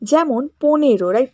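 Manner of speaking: usual speaking voice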